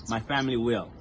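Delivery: ordinary talk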